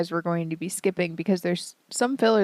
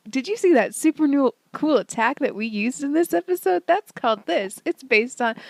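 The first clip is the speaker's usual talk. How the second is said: chirpy recap host; 6 semitones higher than usual. Retold or imitated. Characters in recap quoted.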